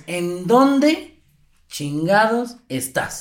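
word by word, pausing after "dónde"; slow scolding mother. Shown as a demonstration. Host showing how moms ask it, slow deliberate scolding. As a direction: stretch "¿en dónde... chingados... estás?" with heavy pauses. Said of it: "No, es".

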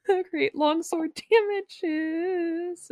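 ordinary talk

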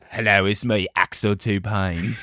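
put-on British accent, pitched low. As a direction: play a British movie villain introducing himself. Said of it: "if you say it like a British guy".

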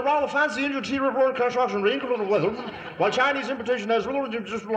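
high, quick plummy gabble; hammed-up toff voice repeated; posh nonsense repeated. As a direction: rattle it off in the silly posh voice.

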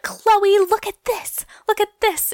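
imitating Kim. Kim calling Chloe over to the iPad, high mock voice. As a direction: squeal it up high like Kim showing sister the iPad. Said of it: "she's like".

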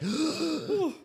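sharp high gasp; a big startled intake of breath. Retold or imitated. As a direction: gasp in mock shock. Reacting in retold drinking story.

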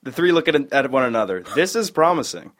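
ordinary talk, nothing marked

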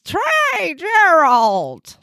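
slow, high drawn-out intro; announcer voice. Acted. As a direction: announce the cohost grandly. Show intro name announcement.

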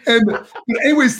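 laughing through the words. Real, not acted. Laughing after the poster joke, still laughing. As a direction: talk while still cracking up.

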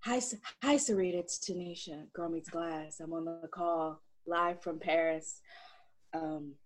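sultry phone voice.

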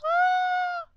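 sudden high squeal of joy; excited squeal. Real, not acted. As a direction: let out an awkward gleeful squeal. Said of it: "Sorry, I'm just so excited".

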